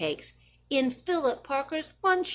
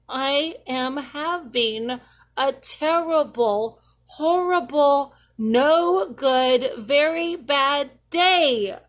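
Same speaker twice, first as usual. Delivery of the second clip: louder, slow, word by word; loud complaint; 3 semitones higher, 5 dB louder, slower than usual. Acted. Child declaring a bad day to the whole family, loud. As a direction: announce the misery to everyone. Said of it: "I told everybody".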